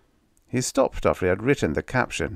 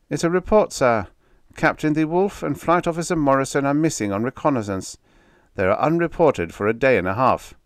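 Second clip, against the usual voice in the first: much higher, timid aide voice; nervous aide; 8 semitones higher, 4 dB louder than usual. Acted. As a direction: deliver the bad news nervously. Nervous aide reporting bad news.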